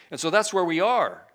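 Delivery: ordinary talk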